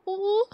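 hammed-up boo-hoo; mock whimper. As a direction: whimper like a sulking child. Fake whimper after being teased.

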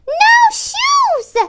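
very high, defiant little shout. A narrator's reading.

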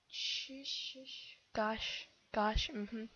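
ordinary talk

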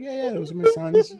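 high put-on woman's voice; mock the mom brightly; mother's reply. Mother answering about her boys, high mock voice.

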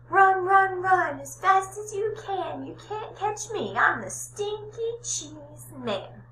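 high, loud cartoon runaway teasing; sing-song brag like a cheeky gingerbread man; high squeaky cheese man, taunting. Cheese man taunts while fleeing.

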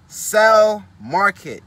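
announcing the next step with force; emphatic. Real, not acted. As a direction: hit the word hard like a headline.

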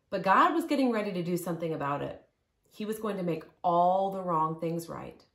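ordinary delivery, nothing marked

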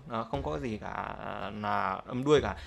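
ordinary delivery

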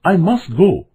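put-on high, insistent young voice; eager, high-pitched plea; urgent cry. A narrator's reading. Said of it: "cried Bambi".

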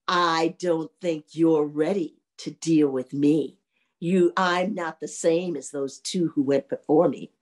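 low, measured ghostly warning; menacing third ghost. Acted. Ghost number three confronts the young man, low slow menace.

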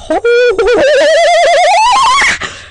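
shrill excited squeal of laughter; high squealing laugh. Real, not acted. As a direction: squeal with delight. Sudden burst at animals.

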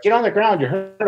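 high voiced bystanders urging him down; mock worried bystanders. Bystanders begging the injured wrestler to lie down, high and fussing. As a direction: pitch it up like fussing onlookers.

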